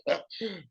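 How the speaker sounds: short laugh. Real, not acted. Laughs at own joke.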